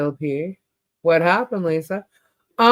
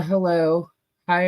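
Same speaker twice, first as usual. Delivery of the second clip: ordinary talk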